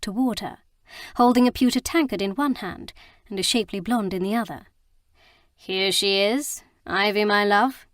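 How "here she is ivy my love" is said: slow, grandly drawn-out proclamation; proclaiming Lord Faris. Acted. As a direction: announce her like a showman, stretching the words. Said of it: "he proclaimed".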